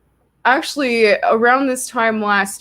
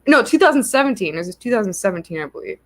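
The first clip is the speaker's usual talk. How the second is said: ordinary talk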